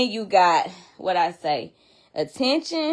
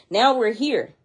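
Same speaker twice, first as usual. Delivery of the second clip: usual speaking voice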